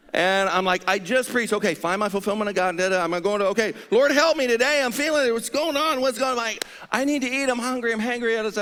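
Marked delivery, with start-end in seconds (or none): 0.9-6.4 high, loud pleading; frantic prayer. Retold or imitated. 6.9-8.4 high, pleading; hangry plea. Retold or imitated.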